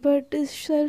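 ordinary delivery

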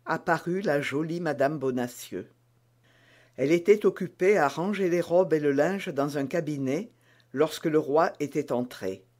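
ordinary delivery

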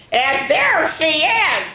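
high, slow, loud squeal; screaming baby. Acted. Baby pointing at the intruder, slow high scream. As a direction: scream each word as a child. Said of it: "screamed Baby".

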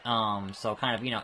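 ordinary delivery

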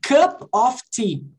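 cup of tea said slowly, the P of "Cup" clearly pronounced; slow demo. Shown as a demonstration. Teacher slowly models "Cup of tea" with a clear P. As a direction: spell out the P in "Cup" slowly for students. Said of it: "Aqui eu pronuncio o P".

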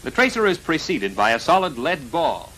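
ordinary delivery

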